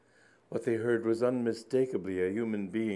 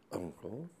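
astonished; 11 dB quieter than usual. Acted. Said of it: "said Anselm, astonished".

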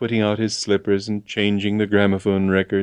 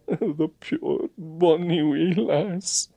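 tearful Scottish sigh; 9 semitones higher, much slower than usual. A narrator's reading. Agent sighing over the girl as he drives off, Scottish. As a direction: coo it in Scots. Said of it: "puir bonny wee lass".

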